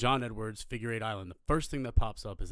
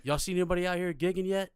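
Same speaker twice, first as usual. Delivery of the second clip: officer calling over; 9 semitones higher, 4 dB louder than usual. Retold or imitated. Officer questions them across boats.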